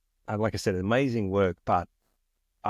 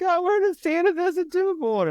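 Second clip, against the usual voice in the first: very high, louder, squeaky mock panic; panicked crew voice; 3 dB louder than usual. Panicked crew telling the new guy about the board, squealing mock panic.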